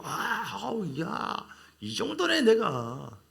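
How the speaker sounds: mock swaggering pride; smug self-praise. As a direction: play the man admiring his own power. Mimics proud gifted believer.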